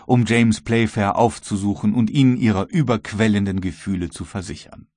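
usual speaking voice

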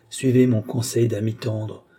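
usual speaking voice

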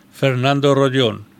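usual speaking voice

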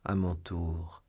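slow, low, held-back voice; trembling restraint. A narrator's reading. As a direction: speak slowly, trembling inside. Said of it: "tremblant lui-même comme le lion".

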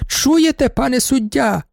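high, indignant baroness voice; crying out. A narrator's reading. Baroness appeals to the judge, crying out high. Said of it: "крикнула баронеса".

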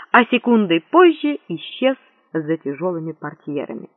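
ordinary delivery, nothing marked

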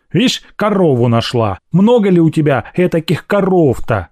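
indignant servant's grumble; grumbling. A narrator's reading. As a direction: grumble with wounded pride. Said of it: "ворчала Аграфена". (ворчливо, с обидой)

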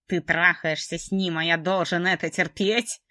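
slow, loud, bitter male accusation; slow jealous man's voice. Acted. Jealous Trevor accuses her.

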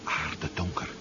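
barely voiced, soft words into the dark; a soft murmur at the window; hushed awe. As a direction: breathe it out, awestruck. Peering into the dark sea.

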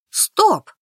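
резко, выше и громче; оборвать ссору криком; громкий окрик. A narrator's reading. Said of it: "закричала я". (громкое «Стоп!»)

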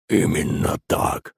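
soft, breathy bubbling creature; gurgling monster voice. A narrator's reading. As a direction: gurgle it out slowly. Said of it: "пробулькал Водяной".

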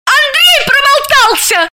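high, slow shriek; взвизгнула. A narrator's reading. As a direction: squeal it out in fury. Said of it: "взвизгнула Заматаева".

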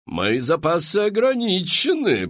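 high, loud, theatrical madame pitching to the crowd; showy saleswoman. Acted. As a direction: sell it like a grand Parisian hostess. Мадам Фру-Фру. (высокий громкий голос мадам-зазывалы)

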